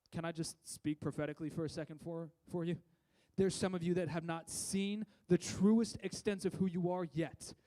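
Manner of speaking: ordinary delivery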